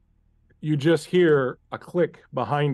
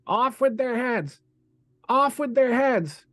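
high, slow, screamed over and over; screaming; 10 semitones higher than usual. Acted. Unseen voice in a vision screaming at the player, high, slow scream. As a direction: shriek the order again and again, drawn out. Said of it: "this voice incessantly screaming".

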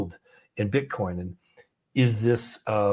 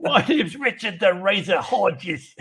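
slow, hammed-up Cockney accent, pitched up; Richard; 6 semitones higher, slower than usual. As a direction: draw out a mock Cockney introduction through laughter. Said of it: "pretend I'm from the U K".

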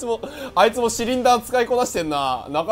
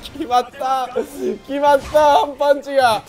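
excited announcer shout; 2 semitones higher, 5 dB louder, slower than usual. Acted. Announcer-style shout of the hit.

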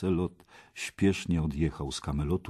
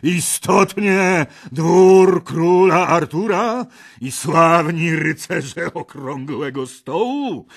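loud scornful laugh through the words, much higher; mocking laughter; 11 semitones higher, 10 dB louder, slower than usual. A narrator's reading. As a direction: laugh at the cowards as you taunt them. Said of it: "zaśmiał się głośno i szyderczo".

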